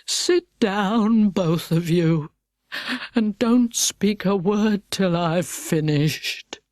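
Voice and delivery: slow, heavy, sorrowful turtle; lightly voiced sad turtle; mournful Mock Turtle. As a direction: drag each word out in grief.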